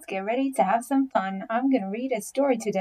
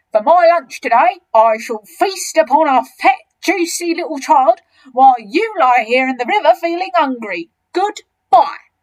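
loud, higher, grandly relishing; enormous crocodile, gloating; 5 semitones higher, 11 dB louder than usual. Acted. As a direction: sweep off with a triumphant farewell.

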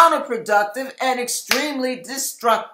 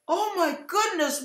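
higher, softer stunned exclamation; shocked gasp; 7 semitones higher, 8 dB quieter than usual. Retold or imitated. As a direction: breathe it out in shock. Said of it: "I'm saying, gasps".

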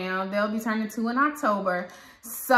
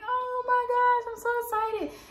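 gushing excitement. Retold or imitated. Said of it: "And I'm like".

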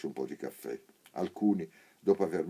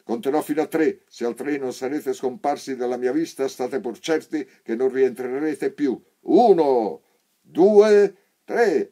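loud, high yelling, each number louder; shouted countdown; 9 semitones higher, 14 dB louder than usual. A narrator's reading. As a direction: count to three as a threat, screaming. Sergeant counts to three shouting.

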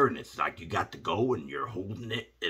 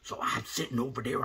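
ordinary talk, nothing marked

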